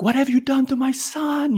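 high, anguished mother demanding answers; frantic mother. Retold or imitated. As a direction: cry out like a panicked mom. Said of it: "my mom's like".

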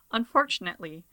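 normal talking voice

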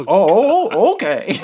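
"Oh" high and loud with a laugh, then "okay"; nervous laugh. Retold or imitated. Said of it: "I was like".

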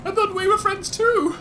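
high, hurt squeak; whiny Rat King. Acted. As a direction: wail it like a betrayed rat. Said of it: "the guy goes".

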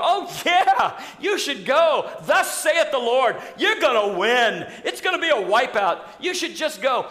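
chuckling sycophant promising victory; eager yes-men. Retold or imitated. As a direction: gush what the king wants to hear. False prophets flattering the king.

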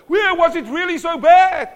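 match their volume, overdone; hammed-up loud sympathy; loud matching reply. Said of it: "And we go".

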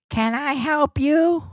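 high, slow mock of a stern clerk; mocking lady's voice. S0 mimics the Quiznos lady asking if he needs help, high and drawn out. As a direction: make her sound snooty and slow.

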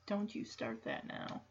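near-whispered mild scolding; quiet scolding. Retold or imitated. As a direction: warn under your breath. Voices Jesse hushing crying Willie.

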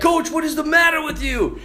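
high frantic plea; panicked player. Retold or imitated. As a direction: beg the coach in panic. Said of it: "They're like".

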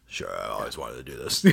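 put-on very low, soft old actor's voice; Ron Perlman. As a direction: drop right down into an agreeable veteran actor. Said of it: "he'll be like".

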